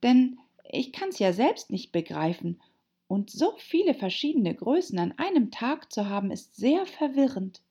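high, courteous Alice voice; polite girl, a bit bewildered. A narrator's reading. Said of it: "erwiderte Alice sehr höflich".

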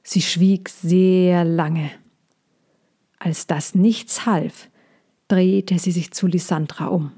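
usual speaking voice

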